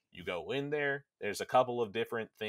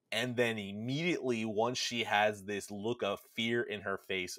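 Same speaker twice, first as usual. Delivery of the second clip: ordinary talk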